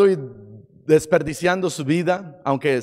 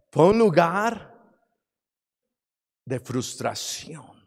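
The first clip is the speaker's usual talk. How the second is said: ordinary talk, nothing marked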